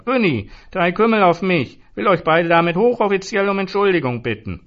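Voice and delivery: put-on high, loud drunken voice; booming drunken round-buyer; drunk bluster. A narrator's reading. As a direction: play the loud sloppy drunk. Drunk Zoran ordering rounds.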